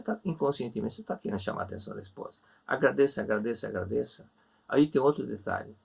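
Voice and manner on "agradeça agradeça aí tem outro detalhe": normal talking voice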